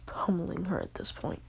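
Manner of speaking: hushed story told in a whisper; whispered storytelling. Acted. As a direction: whisper the whole tale close to the mic. Whispered retelling of anime fight.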